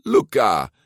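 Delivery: high, loud hello dropping on the name; surprised greeting. A narrator's reading. Farmer greeting Luca out of the blue, surprised. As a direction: greet someone who popped up unexpectedly. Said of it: "riep meneer Branzino verrast uit".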